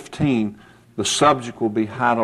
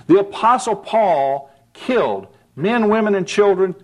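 much higher, impassioned sermon delivery; preaching at full force; 6 semitones higher than usual. Acted. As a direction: preach hard, pressing the point home.

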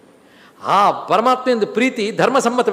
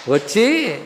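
ordinary talk, nothing marked

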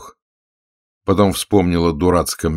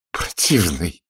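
panting, admiring coo; mock-scolding with heavy, smitten breath; breathless admiration; slower than usual. A narrator's reading. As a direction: say it adoringly, out of breath. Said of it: "тяжело дыша сказала она с восхищением".

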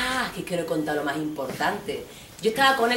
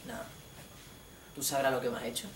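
low, much softer sullen reply; sulking boyfriend, low and soft; sulky boyfriend; 5 semitones lower, 12 dB quieter, faster than usual. Retold or imitated. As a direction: mutter it coldly. Boyfriend sulks, voiced by her.

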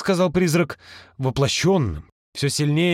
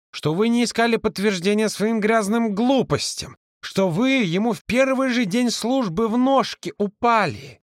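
raised, heated accusation; indignant accusation; 7 semitones higher than usual. Acted. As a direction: throw the charge at him. Angry accusation continues.

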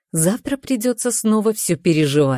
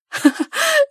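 high delighted laugh; burst of laughter. Real, not acted. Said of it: "не могу сдержать смех".

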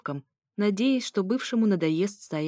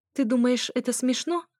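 higher-pitched indignant question; outraged, pitched up; 6 semitones higher than usual. Acted. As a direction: demand it, affronted. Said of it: "возмутилась я".

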